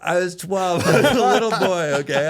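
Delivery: high, loud protest through laughter; real laughter breaking up an insistent plea; laughing self-defense. Real, not acted. Storyteller excusing his naive younger self, laughing protest.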